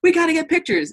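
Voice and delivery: high, fast excited gush; fast, high squeal of eager fans. Retold or imitated. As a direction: mimic eager fans begging for a photo. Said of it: "they're like".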